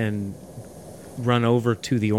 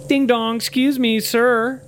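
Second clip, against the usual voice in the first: very high, loud put-on voice; passenger test voice; 12 semitones higher, 6 dB louder, much slower than usual. Testing the fake flight attendant, high put-on call. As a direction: pipe up in a high mock call for service. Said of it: "I'm gonna throw a test at you".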